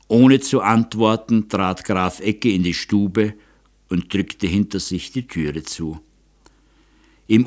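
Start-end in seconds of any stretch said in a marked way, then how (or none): none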